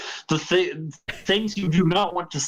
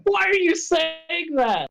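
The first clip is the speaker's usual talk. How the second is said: put-on upset kid; 12 semitones higher, slower than usual. Mock kid reacting to creepy line.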